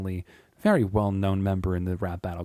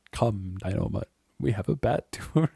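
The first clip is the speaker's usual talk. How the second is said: a hint of cartoon-hero delivery; the Falcon. Repeating a favorite rap line, lightly voiced superhero. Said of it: "I could do the voice".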